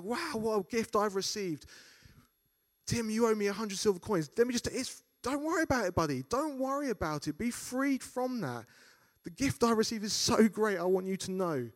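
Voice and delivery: high, loud, reassuring and generous; forgiving servant. Retold or imitated. Imagined servant telling Tim not to worry, high and bright. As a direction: let him off kindly, like a mate.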